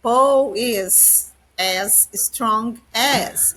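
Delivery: very slow, deliberate English for learners; teacher dictating a model sentence very slowly; very slow example sentence. Shown as a demonstration. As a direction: drag each word so students can follow.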